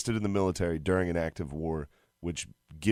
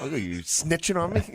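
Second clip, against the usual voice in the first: mock accusing; 9 semitones higher than usual. Dad caught by son.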